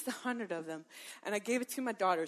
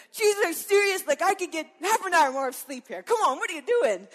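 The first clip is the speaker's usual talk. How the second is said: mock annoyed complaint; 6 semitones higher, 8 dB louder than usual. Hypothetical annoyed reply to Jesus.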